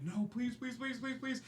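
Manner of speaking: mild screamed pleading. Acted. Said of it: "you just hear screaming".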